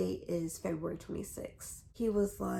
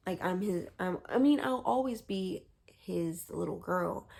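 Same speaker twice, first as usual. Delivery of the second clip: ordinary talk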